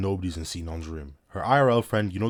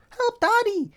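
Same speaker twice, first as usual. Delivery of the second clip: very high put-on little kid voice; child calling daddy; 4 dB louder, slower than usual. Yui on the phone to daddy, high child voice. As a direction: squeal it like a child in need. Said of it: "Yui's on the phone talking about some".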